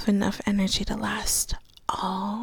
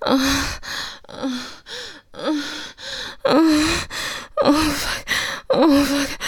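breathy moaning; 5 semitones higher than usual. Acted. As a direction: moan it out, building toward climax. Acted sex scene, building.

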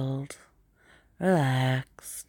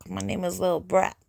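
New York accent; 2 semitones higher than usual. Shown as a demonstration. Showing how New Yorkers say the name, New York accent. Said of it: "If I was from New York".

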